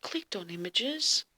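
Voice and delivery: plain reading voice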